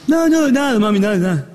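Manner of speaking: high-pitched daughter feigning innocence; playing dumb. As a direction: brush mom off in a high little girl's voice. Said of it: "se hizo la tonta y dice". (haciéndose la tonta, voz aguda)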